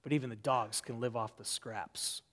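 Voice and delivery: quiet ownership. Retold or imitated. Woman answering Jesus from the dust, quiet and firm. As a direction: own the insult quietly. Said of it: "she owns that insult".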